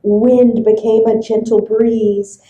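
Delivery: ordinary delivery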